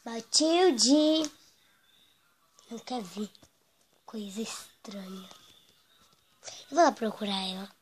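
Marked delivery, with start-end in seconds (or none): 0.0-1.3 calling loudly. Acted. Calling the maid louder. 2.7-5.3 whispered aside. Acted.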